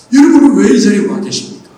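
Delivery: usual speaking voice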